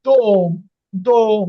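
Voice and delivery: দোম said loud, the ও full and clear; teacher stressing the ও of দোম. Shown as a demonstration. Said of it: "কিন্তু stress বেশি".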